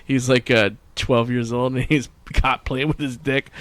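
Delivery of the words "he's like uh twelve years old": ordinary talk, nothing marked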